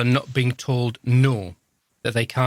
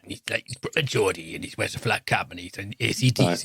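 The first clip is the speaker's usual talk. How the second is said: lightly put-on lower Geordie accent; 3 semitones lower than usual. Host imitates the AC/DC singer's Geordie accent for his co-host. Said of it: "That's my [name] impression".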